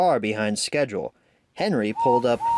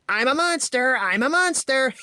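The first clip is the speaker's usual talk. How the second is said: James shouting; 13 semitones higher than usual. A narrator's reading. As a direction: taunt Percy with a mocking monster cry. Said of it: "James shouted".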